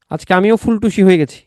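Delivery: ordinary talk, nothing marked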